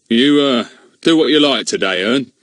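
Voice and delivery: a hint of a blustering village bobby; Mister Goon voice. Policeman tells nephew Ern he is free today, mildly gruff.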